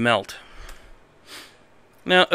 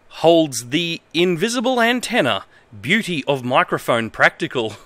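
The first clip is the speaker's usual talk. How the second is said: reads broken manual text in a lightly formal voice; straight-faced reading of nonsense instructions; mock-earnest manual reading; 6 semitones higher, slower than usual. Reads bad manual translation aloud.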